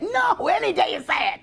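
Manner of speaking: high slow retort; high, slow, fed-up no; exasperated retort. Real, not acted. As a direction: snap back at them, drawn out.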